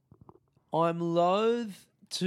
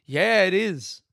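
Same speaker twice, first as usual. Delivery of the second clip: loud, higher deadpan snark; sarcastic agreement; 5 semitones higher, 8 dB louder, slower than usual. Retold or imitated. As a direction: throw the obvious back at her. Sarcastic reply to clerk.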